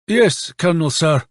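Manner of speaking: put-on high-pitched reply with Polish accent; Polish-accented military acknowledgement; foreign accent. A narrator's reading. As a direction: answer in a light Polish accent. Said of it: "in a foreign accent".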